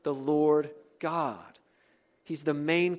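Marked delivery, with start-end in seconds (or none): 0.0-1.6 slow "Lord God", falling to a close; emphasis on the name. Shown as a demonstration. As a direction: set "Lord" and "God" down deliberately. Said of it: "Who's the emphasis?".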